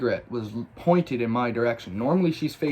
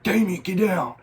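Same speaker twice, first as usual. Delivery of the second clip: raised, high-pitched retold warning; urgent warning; 8 semitones higher, 5 dB louder than usual. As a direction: call out in alarm to a friend.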